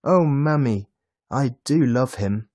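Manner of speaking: put-on high, loud, breathless-with-joy child; excited child's voice, exclaiming in delight; overjoyed little boy. A narrator's reading. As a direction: gush over presents to mother. Boy thrilled by his Christmas presents.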